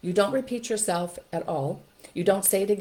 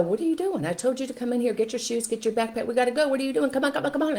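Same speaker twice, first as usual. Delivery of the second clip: put-on higher, pressed, scolding the dawdling child; exasperated parent; 6 semitones higher, faster than usual. Parent scolding child found playing instead of getting ready. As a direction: hurry the kid up, fed up.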